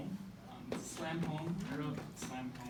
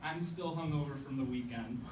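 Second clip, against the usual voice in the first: ordinary delivery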